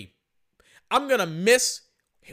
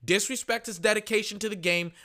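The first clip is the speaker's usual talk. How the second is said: ordinary talk, nothing marked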